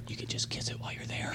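low teasing whisper; soft, breath-only mock taunt. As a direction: drop to a sly, hushed aside. Retold taunt inside an imagined choke.

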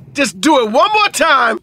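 loud, high, drawn-out dare; mock-menacing, stretching out the warning; acted threat. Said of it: "One of them was like".

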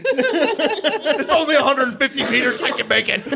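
very high, laugh-choked voice; laughing boast. Acted. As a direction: barely get it out through giggles. Reckless boast amid laughing fit.

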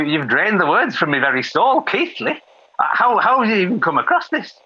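high, shrill-pitched outrage; shocked disbelief. Acted. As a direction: recoil in horror at the name. Shocked outburst continued.

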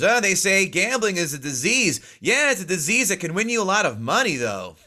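a hint of Norm's dry delivery; Norm Macdonald impression. Retelling his favorite Norm joke in a light Norm voice. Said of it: "That was a decent impression".

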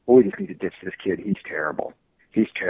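low, soft murmur, dropping away; overheard executives muttering. Retold or imitated. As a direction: mutter it like gossip overheard from across the room.

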